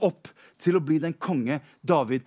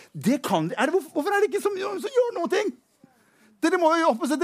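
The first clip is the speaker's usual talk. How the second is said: fast, rising higher into a shrill appeal; urgent indignant plea; 4 semitones higher than usual. Acted. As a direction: push your brothers, climbing more outraged. David urges his scared brothers. (David roper opprørt til brødrene sine)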